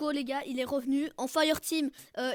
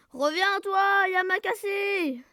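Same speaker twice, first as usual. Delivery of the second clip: loud, higher shout at a runaway; raised, drawn-out battle call; 5 semitones higher, 6 dB louder, much slower than usual. Real, not acted. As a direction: yell after them, stretching the name. Taunting a fleeing enemy in-game.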